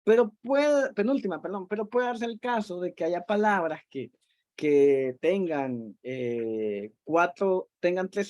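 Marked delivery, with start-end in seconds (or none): none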